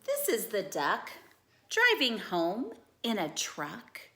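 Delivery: lively storybook read-aloud; animated bedtime-story reading; reading to children. Acted. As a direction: read it like a picture book for kids.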